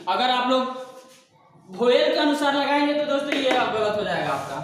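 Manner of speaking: normal talking voice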